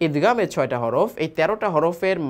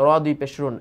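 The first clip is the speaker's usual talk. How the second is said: usual speaking voice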